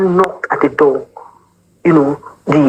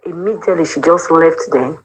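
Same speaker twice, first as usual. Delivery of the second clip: normal talking voice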